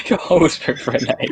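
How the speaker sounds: teasing echo through laughter; giggly mock repetition; laughing mockery. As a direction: parrot the line, cracking up. Mocks the line while laughing.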